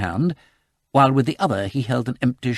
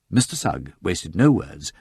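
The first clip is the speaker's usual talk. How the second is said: ordinary delivery, nothing marked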